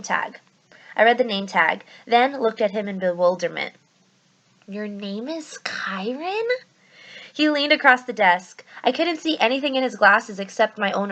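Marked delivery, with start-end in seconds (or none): 4.7-6.5 slow, breathy disbelieving whisper; bewildered whisper. Acted. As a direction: barely voice the question in disbelief. Percy bewildered at the name.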